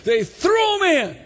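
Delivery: high, exclaimed revival preaching; full-force preaching. Real, not acted. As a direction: preach it at the top of your range, easing slightly.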